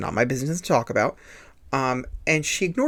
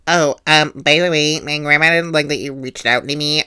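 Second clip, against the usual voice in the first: a lightly put-on catty voice, a bit louder; mock snarky post; 2 semitones higher, 5 dB louder than usual. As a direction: read her post in a slightly snide put-on voice. Reading her Snapchat story mockingly.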